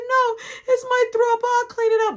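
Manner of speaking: very high mock female voice; high-pitched retold protest; imitated insistent guest. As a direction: act out a woman stubbornly insisting.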